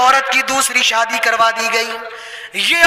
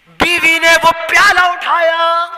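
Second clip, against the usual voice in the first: louder and higher, leaning on پیالہ; emphatic retelling; 4 semitones higher, 3 dB louder than usual. Acted. Preacher dwelling on the wife lifting the bowl, louder. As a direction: say it again with more weight. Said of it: "repeat of the line".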